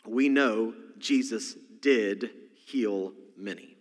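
normal talking voice

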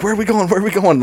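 high, urgent repeated question; retold panic. Fleeing teen asking his friend the way, mock panic. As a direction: imitate frantic asking while on the run.

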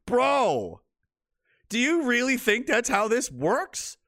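high, slow disbelief; can't believe what he reads; high stunned scoff; incredulous. Real, not acted. Incredulous rant.

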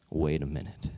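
low, softened voice of dawning discovery; hushed realization. Retold or imitated. Airline agent spots something on the computer, hushed. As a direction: drop it low and quiet, imitating the agent.

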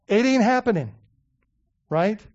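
ordinary delivery

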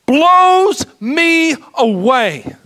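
slow, tearful and loud, voice breaking; crying as he says it; choked up. Real, not acted. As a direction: overcome with real tears. Preacher overcome telling of the cross.

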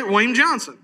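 William Johnson slurred into one quick Southern mumble, high and loud; loud imitation of a rushed Southern introduction; fast Southern slur. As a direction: run "William Johnson" together in a thick Southern accent. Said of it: "he just said it real fast".

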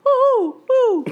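high-pitched woo woo call; high animal hoot. Pretend. Making animal noises.

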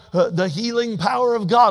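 ordinary delivery

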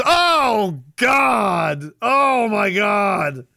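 high, loud, very slow mock exclamation; drawn-out mock shock. Streamer hams up disbelief, slow and loud. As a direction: stretch out the shocked cry.

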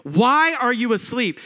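mildly heated accusing question; furious disciples. Acted. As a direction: demand an answer indignantly. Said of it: "They are furious with the Lord".

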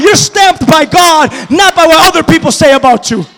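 loud, building sermon delivery; preaching at full force. Acted. Preacher rallying the congregation, at full force. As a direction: drive it home to the congregation.

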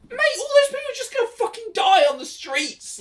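very high-pitched exasperated protest; shouty, squeaky disbelief; mock-outraged, pitch shooting way up; incredulous outburst. Mock outrage at the film's logic.

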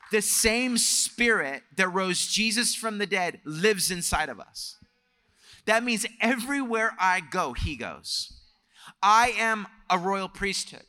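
high, emphatic sermon delivery; impassioned preaching. Real, not acted. Preacher declaring identity as children of the King, impassioned. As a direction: preach it with conviction, pitched well up.

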